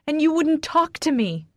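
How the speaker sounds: high, loud accusing cry; scream. A narrator's reading. Wife to husband who shut her out, screaming. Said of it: "I scream".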